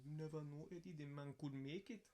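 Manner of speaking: lightly voiced Crucian accent; hint of a Crucian accent, hopeful. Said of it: "in his Crujan accent".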